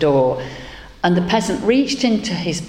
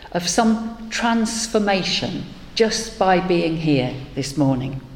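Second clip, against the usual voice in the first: guided meditation; 3 semitones higher than usual. Acted. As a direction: lead the room into calm breaths. Leads a breathing meditation.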